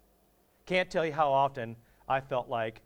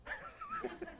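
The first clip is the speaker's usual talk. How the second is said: 1 high laugh; 14 dB quieter than usual. Real, not acted. Laughs at his own question.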